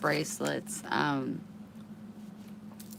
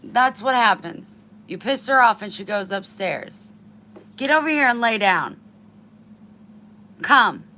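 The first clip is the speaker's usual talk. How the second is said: loud stern commands; scolding; 5 semitones higher, 11 dB louder than usual. Real, not acted. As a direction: order the dog down. Scolding, ordering dog.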